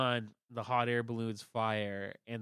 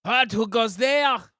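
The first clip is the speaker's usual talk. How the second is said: guard voice; 10 semitones higher, 16 dB louder than usual. Acted. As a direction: bellow like a pompous royal guard.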